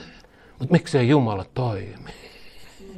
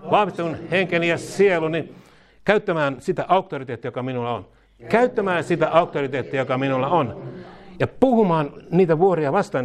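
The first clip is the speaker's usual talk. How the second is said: leading a prayer; 6 semitones higher than usual. Acted. Preacher leading the church in a repeated prayer, fervent call. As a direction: call each line out to be repeated.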